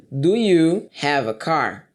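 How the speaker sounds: slow, drawn-out question; slow model question. Shown as a demonstration. Teacher modelling a question to students, slow. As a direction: ask it slowly like a teacher. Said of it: "aqui eu perguntaria".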